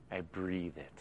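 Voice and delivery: breathed out very softly and low; hushed. Acted. Preacher telling the church how he takes in scripture, nearly hushed. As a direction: let the words almost vanish. Intimate point about the verse.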